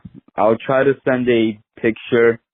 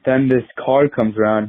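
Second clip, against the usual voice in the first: normal talking voice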